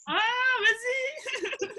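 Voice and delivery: high, laughing burst of joy; excited shout. Real, not acted. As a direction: cry it out with delight. Eager answer to wool questions.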